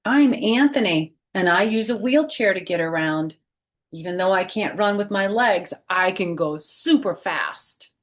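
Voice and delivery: lively picture-book read-aloud voice; reading to children. Acted. Teacher reading a picture book to her class, lively read-aloud. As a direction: read it out like story time for little kids. Reads child character's words.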